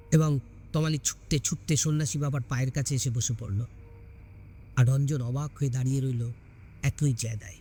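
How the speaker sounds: usual speaking voice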